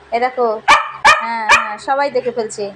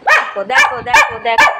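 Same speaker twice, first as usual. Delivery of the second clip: much higher and louder, urgent দেখো দেখো; excited calling; 12 semitones higher, 5 dB louder than usual. Real, not acted. Owner urging viewers to watch the pet, excited. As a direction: call the viewers to look, thrilled.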